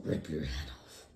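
very low mock-villain threat; menacing threat voice. Gamer threatening an opponent, mock-menacing low voice. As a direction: drop far down into a playful menace.

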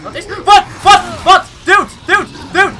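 high, loud frantic repeated calls; panicked yelling. Real, not acted. Gamer panicking as a monster attacks, frantic loud yells. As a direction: blurt it out in rising panic.

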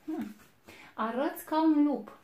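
high, loud sniffing appraisal; a suspicious wolf sizing up his prey, loud; big bad wolf. A narrator's reading. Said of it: "L-a pipăit și a zis".

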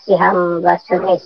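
usual speaking voice

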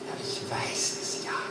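hushed whisper; conspiratorial whisper to the crowd. Acted. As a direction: whisper it like a secret. Secretive aside to the audience.

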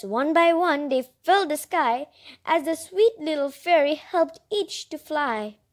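ordinary delivery, nothing marked

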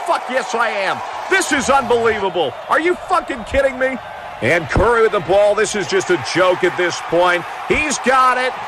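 ordinary delivery

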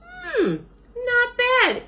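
very high bird voice; pleasantly surprised. A narrator's reading. Bird tastes a carrot, high surprised approval.

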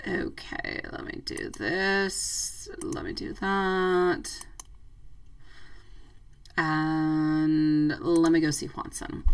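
soft, half-whispered, slow self-talk; muttering to herself. Real, not acted. Reader shuffling cards for Watson, murmuring softly to herself. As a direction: murmur quietly while laying cards.

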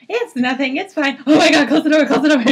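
high, loud fake calm cracking into panic; mock panic. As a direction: pretend it's fine, then lose it. S1 mocks panicking Bart.

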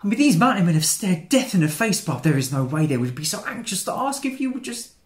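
loud, worked-up insistence; agitated rant. Acted. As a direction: start loud and high, pushing toward the question. Agitated rant nearing unfinished proposal.

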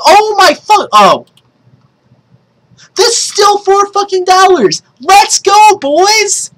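high, thrilled hype shout; real high-pitched celebration; yelling. Real, not acted. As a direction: cry out in excited disbelief. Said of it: "Sorry for yelling".

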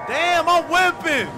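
excited shout. Real, not acted. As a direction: hype it up loudly. Steering the ship fast.

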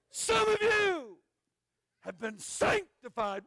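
high, loud, drawn-out preacher call; booming preacher. Retold or imitated. Evangelist preaching to the crowd in a booming voice. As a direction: boom it out like a revival preacher. Preacher quoted in big sermon voice.

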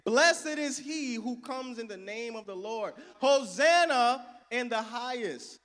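loud, high jubilant cry; crowd shout. Retold or imitated. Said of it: "They were shouting".

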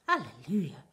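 loud, rough celebratory cry; exultant loud exclamation; jubilant yell. A narrator's reading. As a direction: yell it out in triumph. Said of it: "yelled Grandpa Joe".

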